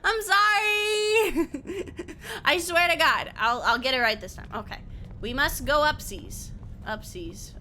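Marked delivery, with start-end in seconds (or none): 0.0-2.4 sorry stretched out through laughter; laugh-cry the apology. Real, not acted. Streamer laughing an apology to chat.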